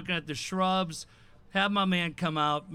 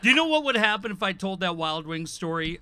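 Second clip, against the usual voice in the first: ordinary delivery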